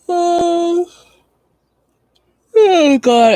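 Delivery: mid-yawn, words stretched out. Real, not acted. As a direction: talk through a big yawn. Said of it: "I yawn and then my eyes start to water".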